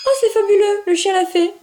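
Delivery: high thrilled cry; real delight when the puppy obeys. Real, not acted. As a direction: burst out in joy. Dog finally obeys.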